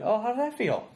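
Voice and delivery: high mock-pitying "how did that feel"; pitying comic. Fellow comic consoling him after a bad set, mock-pitying and high. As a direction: ask it with fake sympathy, up high. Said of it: "That means your act was maybe not that good".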